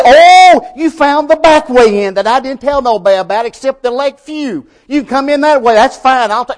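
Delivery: high, loud, sarcastic put-on voice; a preacher lampooning a false, easygoing Christ; mock voice. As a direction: parody a lax gatekeeper waving people in. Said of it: "He didn't say".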